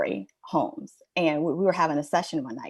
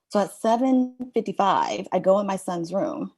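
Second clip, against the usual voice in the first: ordinary talk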